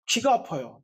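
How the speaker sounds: normal talking voice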